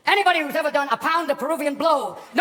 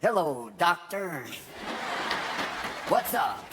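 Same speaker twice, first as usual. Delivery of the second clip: lower, slow sly Nicholson drawl; Jack Nicholson as Bugs; 6 semitones lower, 5 dB quieter, much slower than usual. Retold or imitated. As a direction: leer through the lines like Nicholson. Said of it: "Jack Nicholson as Bugs Bunny going".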